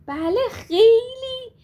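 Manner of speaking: eager high, loud yes; happy. Acted. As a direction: burst out with delight. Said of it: "با شادی گفت".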